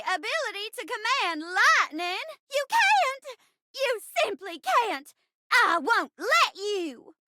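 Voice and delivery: theatrical outrage at a dastardly villain; hammy terror turning to grand defiance; melodramatic defiant heroine. Acted. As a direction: declaim it as a brave, wronged stage bride. Acts heroine defying the villain.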